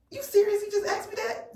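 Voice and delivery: high, incredulous retort at the officer; a shocked, offended "are you kidding me"; disgusted disbelief. Retold or imitated. Vlogger retelling her reaction to the trooper, in outraged disbelief. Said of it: "my flavor was gusted".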